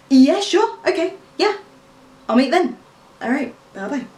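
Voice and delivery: high-pitched, chirpy phone replies; eager phone agreement. Acted. Acted phone reply to interviewer.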